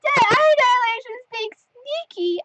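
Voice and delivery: playful squeal. Pretend. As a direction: repeat it in a teasing high voice. Repeats the comment in playful voice.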